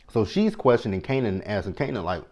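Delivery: normal talking voice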